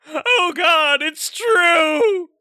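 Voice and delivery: loud, high mock sob; hammed-up tearful outburst; crying shout. Ad customer breaks down.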